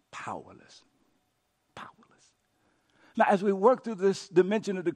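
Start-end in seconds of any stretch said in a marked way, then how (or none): 0.1-2.3 hushed, near-whispered defeat; acted resignation. Person settling for the best they can, whispered resignation. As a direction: let it sink to a whisper.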